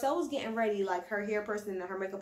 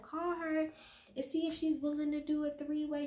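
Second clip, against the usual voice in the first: mock drunk Erica; 7 semitones higher than usual. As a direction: play the drunk friend pushing him.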